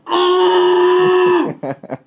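long high-pitched shout into a pillow; high scream. Shown as a demonstration. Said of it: "just go".

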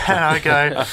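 ordinary talk

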